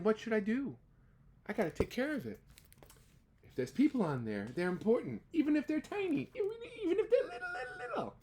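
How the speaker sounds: anxious elephant talking to himself; a touch of tearful pleading; worried Horton. Acted. Horton worrying aloud.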